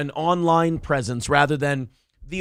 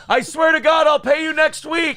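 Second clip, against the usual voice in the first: mock shout; 9 semitones higher, 5 dB louder than usual. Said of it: "Yeah, but it's usually".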